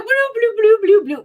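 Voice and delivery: high goofy toy voice; squeaky toy figure chatter; silly toy voice. Pretend. Mock toy voice sounds.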